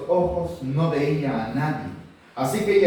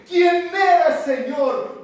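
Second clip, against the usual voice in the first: Paul crying out; 12 semitones higher, 5 dB louder, much slower than usual. Acted. Paul asks the voice from heaven.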